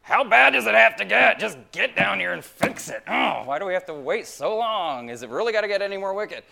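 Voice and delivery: loud, high, exasperated plea; frustrated cry for help; exasperated prayer. Retold or imitated. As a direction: demand God come down now, impatient and loud. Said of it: "Or my endless prayer is".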